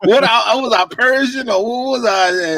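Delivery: high, put-on swami accent; Zoltar. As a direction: keep up the bad mystic accent while puzzled. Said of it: "terrible accent".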